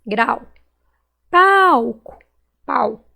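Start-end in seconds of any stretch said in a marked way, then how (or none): 1.3-2.2 "Palco" with a loud, high, long PAL; stretched stressed syllable. Shown as a demonstration. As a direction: call out "Palco", holding PAL. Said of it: "Qual que eu demorei mais falar".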